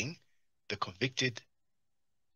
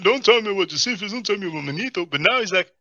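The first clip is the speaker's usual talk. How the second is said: mock indignant; 9 semitones higher, 6 dB louder than usual. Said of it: "first he's like".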